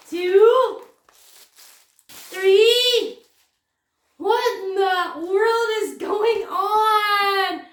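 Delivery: high, loud, drawn-out astonishment; amazed outcry. Real, not acted. Vlogger counts surprise parcels, amazed outcry.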